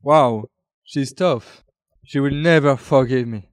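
ordinary talk

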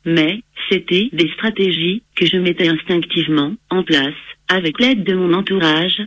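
synthetic computer voice reading text; text to speech voice. Real, not acted. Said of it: "J'utilise un logiciel de synthèse vocale".

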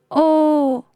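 very high, loud lament; wailing moan. A narrator's reading. Genie realises he is trapped in bottle, wailing. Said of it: "gémit le génie".

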